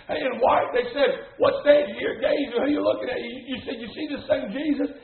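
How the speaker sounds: impassioned sermon delivery; driving pulpit proclamation; preaching. Real, not acted. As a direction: preach it with punchy emphasis to the congregation. Preaches to a congregation.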